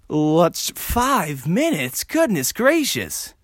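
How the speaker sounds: high, very drawn-out dismay; exasperated disbelief. Real, not acted. Solo host checking the clock, exasperated disbelief. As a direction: stretch each word in disbelief. Dismayed at how little time passed.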